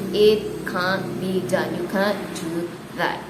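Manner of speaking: normal talking voice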